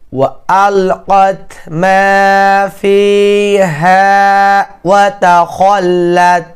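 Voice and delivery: slow, drawn-out tilawat with held vowels; Quran recitation. Acted. Teacher recites Surah Inshiqaq to students, slow melodic tilawat. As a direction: chant each verse slowly for the class.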